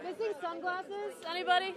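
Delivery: loud call across the room, slow. Real, not acted. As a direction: call out to find the owner. Calling out to the room.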